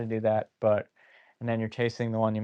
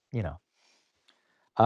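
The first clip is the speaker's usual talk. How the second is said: teary-eyed; 7 semitones lower than usual. Real, not acted. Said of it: "sorry, just a little teary-eyed".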